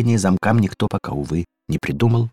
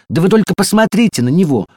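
higher, louder exclamation; indignant appeal; appealing cry; 12 semitones higher, 5 dB louder than usual. A narrator's reading. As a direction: plead loudly to the medic. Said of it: "воззвала дверная ручка".

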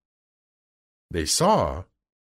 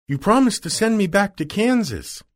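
put-on high, insistent girl's voice; higher-pitched storybook child; Dorothy, little girl; 9 semitones higher than usual. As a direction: plead the case, pitched up. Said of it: "said the little girl".

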